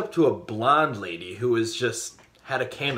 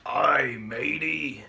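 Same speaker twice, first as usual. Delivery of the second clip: pirate voice; much slower than usual. Acted. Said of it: "What did the pirate say".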